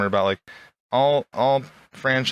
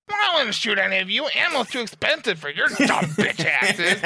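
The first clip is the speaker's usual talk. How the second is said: high, hammed-up honking whine; mock indignant whiner, pitched way up; Metz; 8 semitones higher than usual. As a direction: sneer it out in a high put-on voice. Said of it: "that's Metz as a school shooter".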